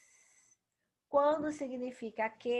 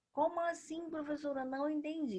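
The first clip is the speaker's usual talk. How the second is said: puzzled student voice, pitched up; lightly voiced confused student; mock student question; 6 semitones higher, faster than usual. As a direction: play the confused pupil asking.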